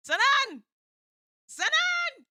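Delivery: high, loud cries of his name; alarmed calling out. Acted. Heroine calling to her collapsed friend, alarmed shout.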